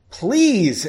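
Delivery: high, loud plea; urgent acted entreaty; pleading. A narrator's reading. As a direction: beg with a drawn, falling please. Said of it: "Erin pleaded".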